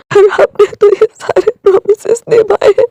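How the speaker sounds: crying as he recalls the promises. Acted. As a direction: sob it out, voice breaking. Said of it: "वो रोने लगा था".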